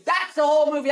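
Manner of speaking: high, loud shout; real exasperated outburst at the movie. Real, not acted. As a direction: yell it out, fed up. Shouted repeat.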